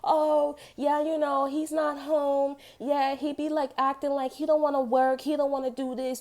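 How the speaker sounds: high mocking imitation. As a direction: put on a prissy high voice, dragging out he's not home.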